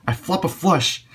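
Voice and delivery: high, delighted exclaim; thrilled cry at the cards; excited. Real, not acted. Excited at flopping a flush.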